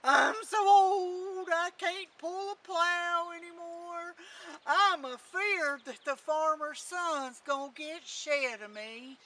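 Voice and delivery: loud, slow, wailing donkey voice; crying old donkey. A narrator's reading. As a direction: wail it out slowly like a worn-out donkey. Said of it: "they heard him a-crying".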